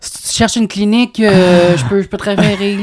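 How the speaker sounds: 1 dismissive cousin. Pretend. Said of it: "j'aurais vraiment dit comme".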